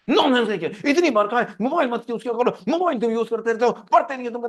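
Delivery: put-on loud, high scolding of a child; acted outburst of parental anger; angry parent scolding. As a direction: tear into the kid. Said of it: "बच्चे से गुस्सा कर रहा हूँ".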